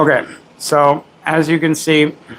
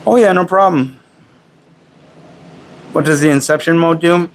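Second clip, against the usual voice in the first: talking while chewing; words round a bite of food; mouth full; 2 semitones higher than usual. Real, not acted. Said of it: "I don't mean to talk with my mouth full".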